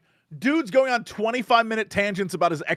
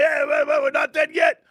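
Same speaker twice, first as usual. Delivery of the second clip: cheery shout; 9 semitones higher, 3 dB louder than usual. Retold or imitated. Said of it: "the man walked up and goes".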